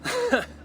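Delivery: short, high mocking laugh; a quick laugh at her weakness; scoffing laugh. Eivor mocking the stranger's excuse, short scoffing laugh.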